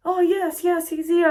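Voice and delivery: imitated receptionist, very high and loud; desk worker. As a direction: put on a high, chirpy receptionist. Voicing desk worker recognising the patient.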